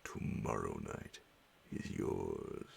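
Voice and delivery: low, heavy vampire voice; deep sinister count; menacing Count. Acted. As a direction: growl it as the Count claiming his night. The Count claims the night.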